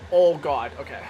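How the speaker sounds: normal talking voice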